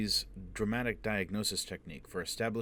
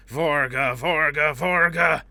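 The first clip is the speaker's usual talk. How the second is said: rising, ever louder shouts, much higher; shouting, building; 8 semitones higher, 14 dB louder, slower than usual. Acted. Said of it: "Foyle shouted".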